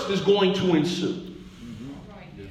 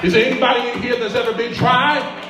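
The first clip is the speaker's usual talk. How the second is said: raised, rhythmic preaching with rising pitch; stacking up the congregation's burdens, preaching higher and higher; building sermon climax; 5 semitones higher than usual. Acted. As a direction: pile on each grievance like a drumbeat. Preacher builds to full force.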